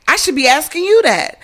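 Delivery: mock the guy turning it back on her; defensive.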